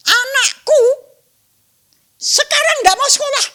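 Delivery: high-pitched, loud acted tirade; angry mother. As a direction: yell accusingly as an outraged mother. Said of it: "ndak terbiasa marah ya". (ibu marah, berteriak tinggi)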